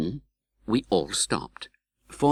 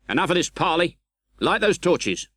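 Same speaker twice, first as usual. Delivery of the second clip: commanding officer; 9 semitones higher than usual. Acted. Sergeant gives orders.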